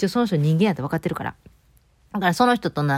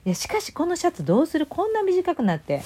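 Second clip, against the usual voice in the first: high, dismayed cry over the shrunken shirt; finding her shirt has shrunk in the wash, pitched up in real dismay; shocked; 11 semitones higher than usual. Real, not acted. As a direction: wail about the ruined shirt, pitched up. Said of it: "もう超ショックやねんけど".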